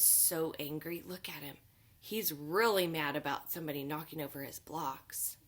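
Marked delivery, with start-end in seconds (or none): none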